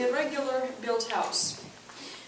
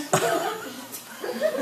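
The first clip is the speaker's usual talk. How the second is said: high giggle; 10 semitones higher than usual. Real, not acted. Reader laughs.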